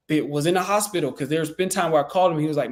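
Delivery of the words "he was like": usual speaking voice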